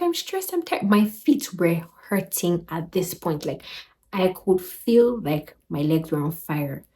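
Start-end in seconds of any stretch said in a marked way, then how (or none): 0.0-0.8 high, softened mock-tearful plea; tearful retelling. Crying traveler answers the front desk lady, tearful high retelling. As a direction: imitate crying through stress in a much higher voice. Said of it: "I'm crying again".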